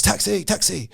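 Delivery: imitating a Cockney cab driver calling out; Cockney cabbie. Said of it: "it sounded like it was a Cockney".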